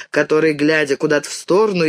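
usual speaking voice

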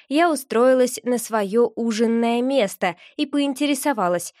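ordinary delivery, nothing marked

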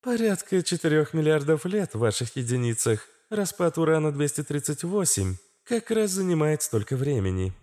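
high female system voice; informative Astra. A narrator's reading. Astra explains uranium decay, high informative voice. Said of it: "сообщила Астра".

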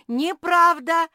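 very high, very loud, drawn out; unyielding yell. A narrator's reading. Squirrel refusing to yield, high yell. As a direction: scream the denial without giving in. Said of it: "не уступил Чинк".

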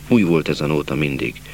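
ordinary delivery, nothing marked

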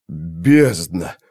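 groan. A narrator's reading. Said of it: "простонал сержант".

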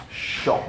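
SHO with a long soft sh. Shown as a demonstration. Teacher contrasts the feminine letter, soft drawn-out SHO. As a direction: let the sh in SHO hush softly.

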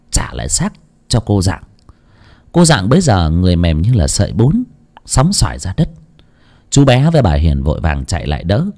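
plain reading voice

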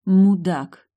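plain reading voice

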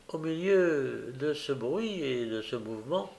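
usual speaking voice

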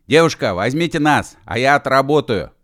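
shouting on the move. A narrator's reading. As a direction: call out pleadingly while running. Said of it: "кричал на ходу Машков".

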